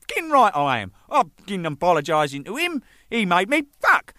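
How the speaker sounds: high, loud angry retort; loud mock-outraged protest; retold indignant outburst. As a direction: snap back hotly, acting out the argument. Retold row, indignant reply.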